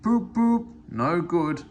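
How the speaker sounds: loud, gruff poop of a big engine; grumbling big engine. A narrator's reading. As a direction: grumble out the whistle. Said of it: "grumbled Gordon".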